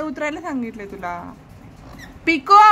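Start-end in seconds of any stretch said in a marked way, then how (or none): 2.3-2.6 sharp call. Real, not acted. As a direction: call Pico's name loud and sharp. Mother calls the child sharply. (मुलाला मोठ्याने हाक)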